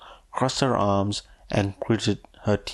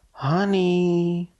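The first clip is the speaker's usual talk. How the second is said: cried out; 10 semitones higher than usual. A narrator's reading. Mother rushing at her son, crying out high. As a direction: call out Honey with delight, pitched high. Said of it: "she cried out".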